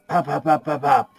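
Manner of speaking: high, rapid burst of pops; imitated gunfire. Player's character walks in holding weapon up, imitated gunfire. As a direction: rattle off gunshots by mouth.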